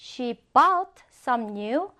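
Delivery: very slow careful sentence; slow example reading. Shown as a demonstration. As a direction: read it slowly for learners. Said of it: "그녀는 몇 점의 새 가구를 샀다". (천천히 예문 읽기)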